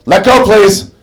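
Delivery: guide calling out. Retold or imitated.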